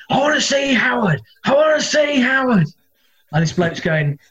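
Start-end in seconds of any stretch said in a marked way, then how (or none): 0.1-2.7 much higher, insistent fan at the door; a stranger demanding to be let in. Retold or imitated. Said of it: "I just hear this voice going".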